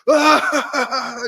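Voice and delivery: high, loud burst of laughing; mock crowd laugh. Manager imitating the pranksters' laughter, loud and high.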